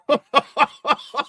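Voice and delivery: high burst of relieved laughter; sudden laugh as the prank sinks in. Real, not acted. Relief after April Fool's realization.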